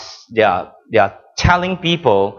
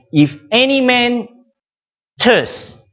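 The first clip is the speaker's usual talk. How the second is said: imitating raised voice; 4 semitones higher, slower than usual. Preacher voices Jesus crying out over the noisy worshippers, mildly raised voice. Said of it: "raised his voice and cried out".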